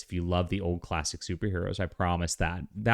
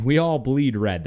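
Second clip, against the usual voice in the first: mock Trump line; 5 semitones higher, 6 dB louder than usual. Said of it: "that was what Trump said".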